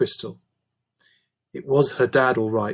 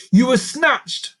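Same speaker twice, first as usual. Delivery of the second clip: ringing declaration; 11 semitones higher, 5 dB louder, slower than usual. A narrator's reading. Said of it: "Lucy's voice echoed around the cavernous room".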